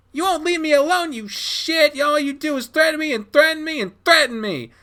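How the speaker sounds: high, shrill screaming through tears; tearful screaming. Acted. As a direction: scream it, close to crying. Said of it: "swallow back a blubbering cry".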